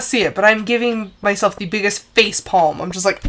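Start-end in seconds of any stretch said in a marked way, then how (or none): none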